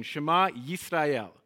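slow call, "Shema Yisrael" with the Y sounded; slow Hebrew call. Shown as a demonstration. Teacher calling out Hebrew for the congregation to repeat, slow and clear. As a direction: lead the call slowly for them to echo. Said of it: "So let's say that again".

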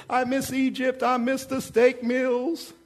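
deliberately awful nasal whinge; horrible whiny voice. Acted. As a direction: whine like a spoiled grumbler pining for the old days. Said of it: "That's just a horrible voice".

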